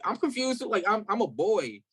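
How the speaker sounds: high-pitched, baffled self-quote; confused protest. Retold or imitated. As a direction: replay bewilderment with the pitch lifted high. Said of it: "And I'm like".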